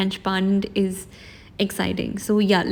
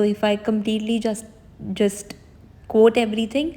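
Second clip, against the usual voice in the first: normal talking voice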